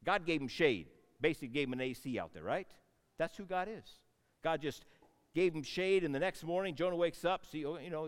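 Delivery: normal talking voice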